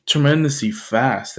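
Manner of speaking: ordinary delivery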